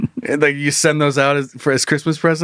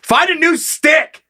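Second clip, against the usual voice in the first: exasperated high-pitched dad yell; mock-scolding shout, pitched way up; 12 semitones higher, 3 dB louder, much slower than usual. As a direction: yell it like a fed-up dad at his kid. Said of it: "They're yelling at your dad".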